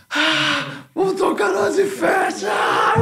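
telling his bandmates they got the gig, shouting very high; ecstatic shout. Retold or imitated. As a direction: scream it out like you just got the best news of your life. Shouting the good news.

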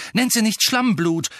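loud, high, indignant retort; very angry. A narrator's reading. Friends defending the girl from an insult, very angry. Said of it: "sagten Ron und Ginny gleichzeitig und sehr zornig".

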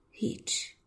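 unvoiced whisper of one word. Lucy whispers her sad answer to Pierre. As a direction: barely breathe it out.

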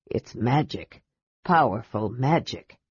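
low, soft and hoarse. A narrator's reading. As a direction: rasp it out uneasily. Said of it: "adding hoarsely".

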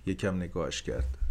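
ordinary delivery

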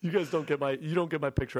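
ordinary talk, nothing marked

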